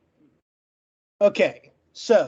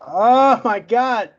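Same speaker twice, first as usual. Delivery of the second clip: drawn-out, louder "Oh my God", slow; exasperated groan; 2 semitones higher, 6 dB louder, much slower than usual. Real, not acted. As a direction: let the frustration spill out in a long groan. Finally past the stutter, exasperated.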